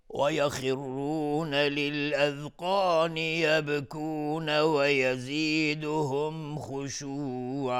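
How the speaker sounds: slow, high, drawn-out tajwid chant; Quran recitation. Acted. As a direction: chant it slowly like a qari. Quran recitation after ta'awwudh. (تلاوت قرآن با صوت کشیده و آهسته)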